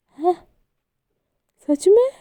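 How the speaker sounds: surprised disbelieving hā̃, sach mein. Acted. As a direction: caught off guard, voice jumps up. Sanvi surprised by being called jaan.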